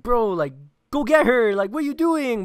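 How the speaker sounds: put-on frantic woman begging someone to go get the girl; panicked yelling. Retelling a panicking woman urging someone to fetch the girl, high frantic yell. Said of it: "she starts freaking out".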